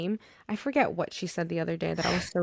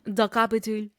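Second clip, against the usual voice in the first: mock French "The Capitole"; Frenchified Capitol, stress on "-tole"; French accent. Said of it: "is that why it's called the Capitole?".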